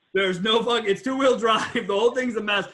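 loud laughing exclamation. Real, not acted. Telling the buyer story, laughing loud. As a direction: laugh it out loudly.

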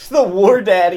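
loud high laughing; laughing excited. Real, not acted. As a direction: laugh through the words.

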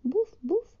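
put-on high, surprised baby dragon puffing; high-pitched baby dragon huffs in surprise; surprised little dragon. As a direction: puff it out like a startled little dragon. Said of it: "repitió el pequeño dragón sorprendido".

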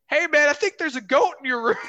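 high, loud call down a hallway; a senior calling out, half amused, that a goat is in the room. Retold or imitated. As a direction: sing it out to the guys down the hall. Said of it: "sticks his head out in the hallway and goes".

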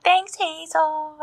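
high, slow, sweet; cute kid voice. Retold or imitated. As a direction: thank her like a child. Boys thank, slow high.

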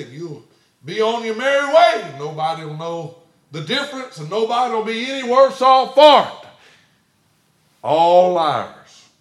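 preaching at full force; fiery preaching. Acted. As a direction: build to a thundering warning.

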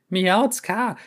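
high squeaky call; squeaky high cat call; squeaked name. Acted. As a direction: squeak her name to get her attention. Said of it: "quietschte er".

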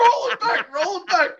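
high, loud excited call; excited demand. Real, not acted. Guest demanding they replay the tape, excited high shout. Demanding proof of disputed claim.

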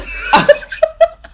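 high, loud breathy giggle fit; burst of laughter. Real, not acted. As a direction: crack up helplessly.

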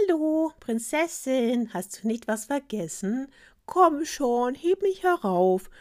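high, slow, sing-out frog call. A narrator's reading. Frog nags the princess from the floor, calling up. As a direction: draw the words out, calling up to the bed. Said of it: "rief zu ihr hinauf".

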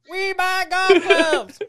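stretched high squeaky delivery; high slow mock pitchman. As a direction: drag out the slogan high. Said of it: "they're like".

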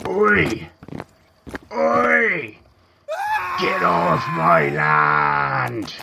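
high bellowing Oi, then slow shout; angry shout. Acted. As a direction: yell them off your land. Troll yelling at trespassers.

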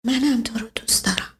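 shy breathless whisper, quick; barely audible whisper. A narrator's reading. Blushing Leili whispering her love to the boy, barely audible.

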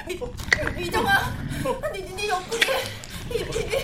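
high, laughing stammer at a strange sight; laughing alarm. Acted. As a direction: giggle through the words in shock. Friend startled, laughing about mouth.

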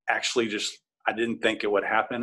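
plain reading voice

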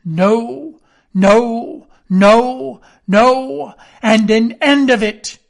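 loud, high, furious refusal; crying out. A narrator's reading. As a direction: bark the refusal at the fleeing man. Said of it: "he cried once".

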